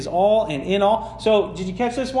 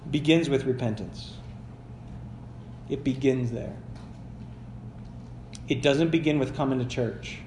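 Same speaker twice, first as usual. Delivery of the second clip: lower, softer earnest appeal; softly sincere preacher pleading with listeners; sincere, quiet; 6 semitones lower, 8 dB quieter than usual. Acted. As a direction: lean in and say it gently.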